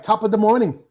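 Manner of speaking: high mock Irish greeting; Irish accent. Host asking guest to say it, mock Irish accent. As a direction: say it high, with a put-on Irish lilt. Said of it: "Say, like".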